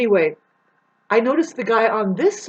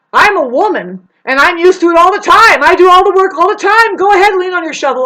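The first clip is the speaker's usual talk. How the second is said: loud, higher mocking jab; sarcastic retort; 8 semitones higher, 11 dB louder than usual. Telling the man off about leaning on the shovel, loud sarcastic. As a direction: throw it back at him with sarcasm. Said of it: "I kinda said it like that".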